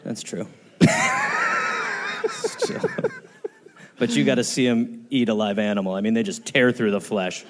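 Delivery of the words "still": high squealing laugh; shrieking laugh. Real, not acted. Laughing at a joke about the English. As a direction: let a laugh shoot up to a squeak.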